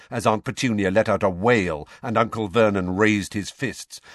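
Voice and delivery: plain reading voice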